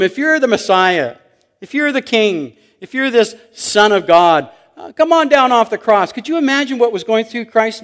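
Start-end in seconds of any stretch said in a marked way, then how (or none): 0.0-6.1 louder, raised voice, goading him; taunting crowd. Acted. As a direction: call out a challenge to prove it. Acted jeering crowd at the cross.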